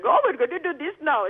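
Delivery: high-pitched mock Indian accent; silly Indian accent. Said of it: "speaking in some silly, um, uh, Indian accent".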